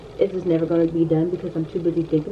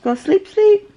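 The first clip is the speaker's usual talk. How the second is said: coaxing sing-song; 9 semitones higher, 4 dB louder, slower than usual. Real, not acted. Coaxing toddler to sleep.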